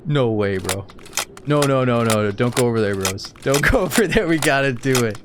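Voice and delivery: loud high laughing no no no; excited laughing panic. Real, not acted. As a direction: yell it out between laughs.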